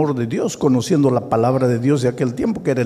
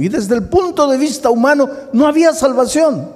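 preaching; 12 semitones higher than usual. Real, not acted. Preaching at full force.

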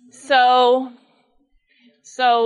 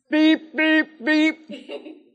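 horn beeps; 5 semitones higher, much slower than usual. Retold or imitated. Father honking imitated.

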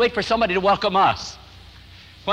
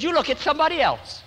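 mock accusation; 10 semitones higher than usual. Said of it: "They said".

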